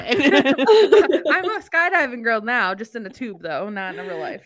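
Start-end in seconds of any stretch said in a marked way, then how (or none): 0.1-2.7 laughing. Real, not acted. Joking about floating in tube.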